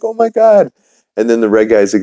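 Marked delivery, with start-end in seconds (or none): 0.0-0.7 Peter, surprised. Acted. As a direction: shriek the greeting up high. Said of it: "And there's like".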